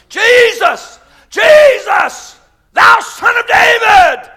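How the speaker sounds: very high, loud drawn-out shouts; yelling out. Acted. Blind Bartimaeus calling to Jesus, desperate yelling. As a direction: yell desperately over a crowd. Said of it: "when he yelled out in a crowd".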